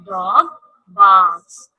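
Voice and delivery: barks said loud and low; stress on barks. Shown as a demonstration. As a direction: punch the word barks like a bark. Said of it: "Dog sound is bark".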